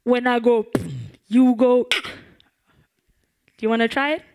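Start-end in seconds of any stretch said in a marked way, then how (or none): 0.0-1.8 loud call to the crowd; leading a call and response. Shown as a demonstration. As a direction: call it out for them to echo. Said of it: "all you have to do is this".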